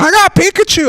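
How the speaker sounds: high, loud excited squeal; excited cry. Retold or imitated. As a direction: yell it like you just caught the prize. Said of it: "just wandering around going".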